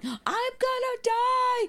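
very high, drawn-out mock shriek; slow, hammed-up wailing scream; Stevie screaming. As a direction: imitate a hysterical child in mortal terror. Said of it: "Stevie's screaming, going".

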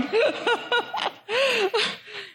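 a high, bright burst of laughter. Real, not acted. Pastor laughing at the Lent bread joke, high laugh. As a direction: laugh at your own teasing.

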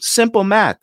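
high, smug "Simple math" with a falling end; imitated salesman. Salesman talking down to Chris, high and smug. Salesman repeats his phrase.

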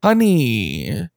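lightly put-on catty fashion adviser; mock endearing critic. Host needles the henchman about his belt, mock endearing. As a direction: tut over an outfit with mock sweetness.

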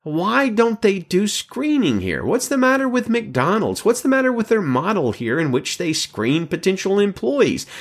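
high, indignant ranting complaint; mock indignation. Pastor voicing Keller fuming about McDonald's hiring, indignant. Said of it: "Then I got more mad".

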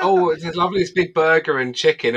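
mocking gloating friends. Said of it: "They're like".

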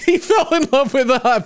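laughing, high. Real, not acted. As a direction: laugh while saying it.

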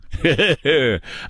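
amused chuckle, real; real cackle. Real, not acted. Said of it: "I'm cackling".

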